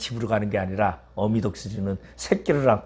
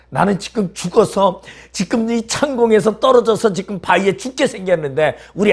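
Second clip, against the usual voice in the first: much higher, louder pleading complaint; desperate acted plea; 9 semitones higher, 6 dB louder than usual. Believer protests to God in trials, high and loud. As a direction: cry out that you're about to die.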